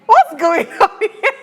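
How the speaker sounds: high, slowed words breaking into laughter; real laughing surprise; laughing disbelief. Real, not acted. Host laughs at repeated answers.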